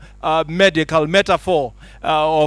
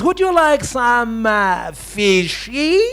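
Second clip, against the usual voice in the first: slow, higher mock pidgin offer of fishy; mocking broken English; 3 semitones higher, much slower than usual. British guy patronizing the Chinese delegate, slow mock pidgin. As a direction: drag it out like talking down to a foreigner. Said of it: "So the British guy says".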